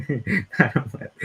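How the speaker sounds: slow, high, mocking drawl on Madam Web; sarcastic. Shown as a demonstration. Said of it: "that's me being sarcastic there".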